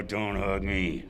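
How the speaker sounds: slow, soft, resigned protest; half-hearted mock defeat; weary protest. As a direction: drag it out like you've given up. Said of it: "And I'd go".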